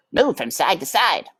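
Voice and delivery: lightly voiced brother; a hint of a bossy brother; Furlough. A narrator's reading. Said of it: "instructed Furlough".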